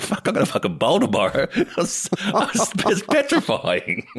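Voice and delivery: high, helpless laughing delivery. Real, not acted. Player retelling a terrifying hostage exercise, laughing high. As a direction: break up laughing mid-story. Laughing while telling a scary story.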